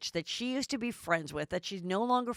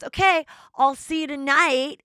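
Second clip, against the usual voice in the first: imitated Peter; 8 semitones higher, 10 dB louder than usual.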